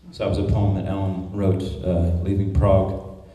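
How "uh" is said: usual speaking voice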